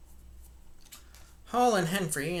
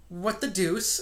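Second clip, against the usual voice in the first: ordinary delivery, nothing marked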